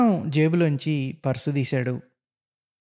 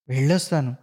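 ordinary delivery

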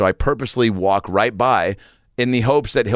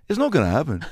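higher Scouse voice, gentle refusal; 6 semitones higher than usual. Retold or imitated. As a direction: let him down kindly in Liverpool tones.

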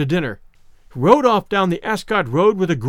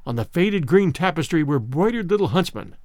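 ordinary delivery, nothing marked

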